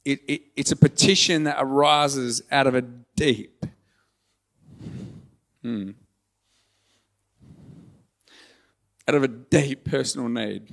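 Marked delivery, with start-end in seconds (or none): 2.5-10.7 halting, with a long pause to hold back tears; fighting back real tears; choked up. Real, not acted. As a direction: try to continue through welling emotion. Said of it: "I'm crying".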